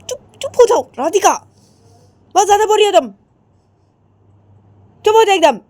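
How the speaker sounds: high, loud, commanding; mother shouting. Acted. Mother yelling at Radhika to shut up, loud command. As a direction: shout her daughter into silence.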